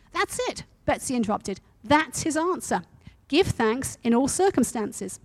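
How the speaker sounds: a preacher reading a story to his church. A narrator's reading. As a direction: tell the story with steady energy. Reads story aloud to congregation.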